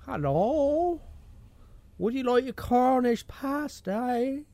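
high, drawn-out mock Chinese-Cornish accent. Offering a pasty on request, mock Chinese-Cornish accent. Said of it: "do a Chinaman that's lived in Cornwall".

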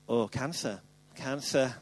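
low, soft dread at the word cancer; half-joking panic; mock dread. Hypochondriac conclusion.

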